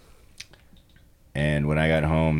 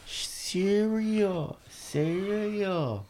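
put-on high, soft little-kid voice; toddler voice; 13 semitones higher, 7 dB quieter, much slower than usual. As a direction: say it like a tiny child asking. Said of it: "when he goes".

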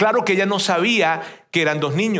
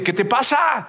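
higher-pitched, indignant challenge; angry confrontation; 6 semitones higher, slower than usual. Retold or imitated. Preacher re-enacting his quarrel with God, angrily confronting. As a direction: re-enact telling someone off. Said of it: "me enojé con Dios y le dije".

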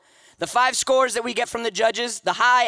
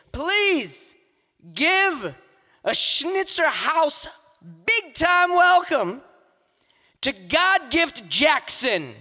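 drawn-out, higher-pitched announcer call; hype welcome call; 5 semitones higher, slower than usual. Real, not acted. Host hyping the crowd for the first poet, big drawn-out call. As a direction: build the crowd up as you bring the poet on.

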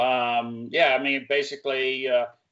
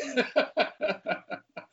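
high-pitched laughter; real laugh; 13 semitones higher than usual. Real, not acted. Patient reacting to host's service joke, high laugh.

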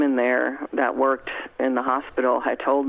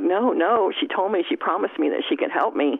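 higher-pitched, insistent argument with oneself; retold pleading protest; 6 semitones higher than usual. Woman retells fighting the voice in her head, pleading protest. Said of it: "I'm fighting with this voice".

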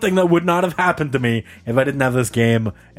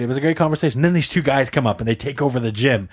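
ordinary talk

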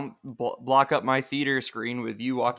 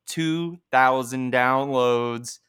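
two thousand downloads drawn out slowly; slow proud announcement; 4 dB louder, much slower than usual. Real, not acted. Host proudly announcing download milestone to listeners, drawn out slowly. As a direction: savor each word of the milestone.

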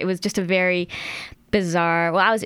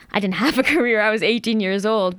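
laughing it off, a bit louder and higher; 4 semitones higher, 3 dB louder than usual. Real, not acted. Author laughing to the host about her old career.

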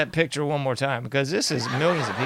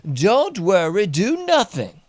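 higher and louder singsong mockery; mocking slogan; 7 semitones higher, 5 dB louder, slower than usual. Mocking viewers about elections, in a higher singsong taunt. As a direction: chant it like a taunting jingle.

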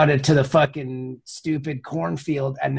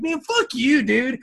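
mock outburst; 10 semitones higher, slower than usual. Retold reaction to Sisko scene.

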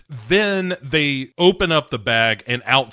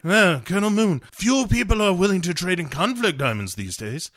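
mock suave British spy, pitched up; imitation movie spy voice, raised a little; James Bond; 3 semitones higher, 3 dB quieter than usual. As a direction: play a hammy British secret agent. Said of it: "Bond is immediately like".

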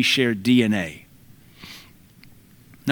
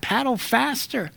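high, slow joking urgency; mock horror-movie advice; lightly hammed-up alarm; comic warning; 10 semitones higher, 3 dB quieter than usual. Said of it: "banjo music like that".

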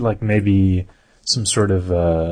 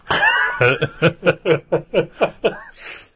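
a long, real laugh; hearty laugh; 9 semitones higher than usual. Real, not acted. Laughing at own joke.

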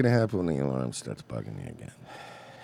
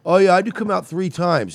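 much higher, hammed-up mocking voice; high mocking caricature, pitched up and louder; mock airheaded voice; 13 semitones higher, 8 dB louder than usual. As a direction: parody her breezy claim with a high put-on voice. Mocking a person's coming-out claims.

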